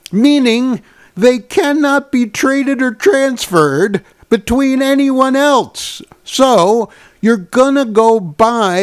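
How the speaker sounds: rising into a furious rant; outraged. Real, not acted. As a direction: build from disbelief to furious outburst. Rising outrage at Louis Vuitton.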